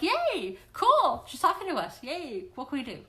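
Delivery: eager cheering angel voices; excited angels. Retold or imitated. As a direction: play giddy angels thrilled to be called.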